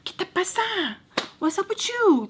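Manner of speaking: high, sharp challenge sliding from Spanish to English; exasperated scolding. Acted. Scolding herself for not thinking straight, high exasperated voice.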